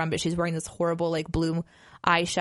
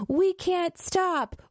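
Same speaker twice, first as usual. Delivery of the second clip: high, drawn-out mock wail; hammed-up narrator; 11 semitones higher, much slower than usual. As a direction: imitate a narrator overplaying it, stretching the words way up high. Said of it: "he also just super played it up".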